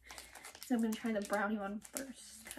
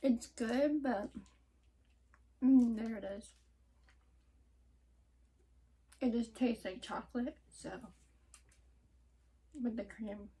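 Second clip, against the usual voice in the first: talking while chewing candy; muffled through food; mouth full, chewing. Real, not acted. Seen 1: Bites candy at -4; puffed cheeks, chewing while holding bitten bar.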